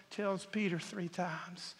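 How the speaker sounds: normal talking voice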